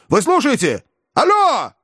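high shouted phone call; shouting into phone. A narrator's reading. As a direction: yell into the receiver. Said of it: "опять закричал в трубку".

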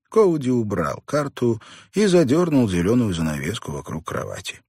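ordinary delivery

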